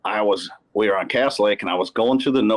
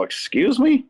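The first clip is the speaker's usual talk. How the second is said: a much higher, sharp excuse me; incredulous; 13 semitones higher than usual. Retold or imitated. Angler insulted by his co-angler, incredulous high retort. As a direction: act stunned by the insult.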